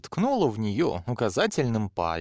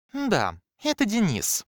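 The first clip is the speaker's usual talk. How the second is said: scoffing; 9 semitones higher than usual. A narrator's reading. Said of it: "хмыкнула бунтарка".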